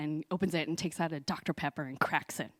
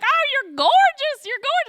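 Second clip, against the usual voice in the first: gushing grandma; 11 dB louder than usual. Retold or imitated. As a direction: gush over her like a proud grandma.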